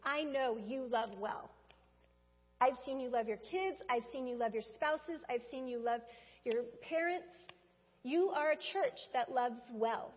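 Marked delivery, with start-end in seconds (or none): none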